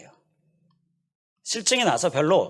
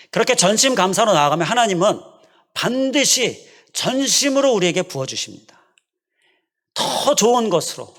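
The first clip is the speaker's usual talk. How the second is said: preaching climax; 7 semitones higher, 6 dB louder than usual. Acted. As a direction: proclaim it with full conviction. Sermon climax.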